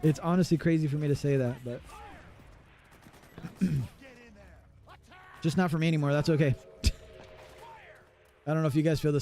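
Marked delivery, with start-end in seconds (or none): none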